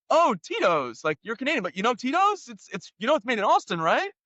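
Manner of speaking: high, chatty imitation of the cop; mock-cheerful police officer; cop's voice. Cop voiced in retold traffic stop.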